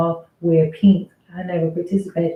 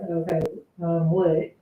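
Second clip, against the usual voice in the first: softer, unenthused reply; not happy; 2 semitones lower, 5 dB quieter than usual. Shown as a demonstration. Worker showing how she answered her manager, subdued and unenthused. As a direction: answer with no cheer whatsoever. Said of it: "I'm just like".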